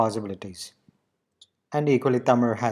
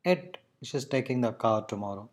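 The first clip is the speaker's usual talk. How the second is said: ordinary talk, nothing marked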